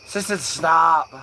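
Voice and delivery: high, slow hammed-up Midwestern voice; mock Midwesterner scolding; Midwestern accent. Stoner voicing a Midwesterner, Midwestern accent. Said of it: "the Midwestern folk".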